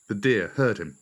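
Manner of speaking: ordinary delivery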